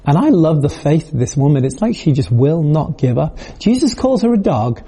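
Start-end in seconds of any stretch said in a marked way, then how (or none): none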